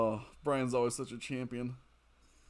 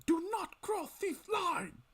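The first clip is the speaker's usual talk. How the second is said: villain shout; 3 dB quieter, slower than usual. Retold or imitated. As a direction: bellow the warning like a pompous pharaoh. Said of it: "That's a terrible impersonation".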